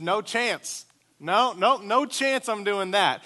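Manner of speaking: put-on a six-year-old saying no way; firm refusal. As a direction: dig in and refuse, kid-style. Scared kid refusing dad.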